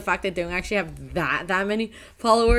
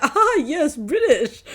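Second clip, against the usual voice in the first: exaggerated British greeting, pitched up; mock British accent; 5 semitones higher, slower than usual. Gamer mocking the British voices in every game, British accent. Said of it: "games that have British accents".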